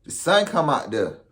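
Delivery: normal talking voice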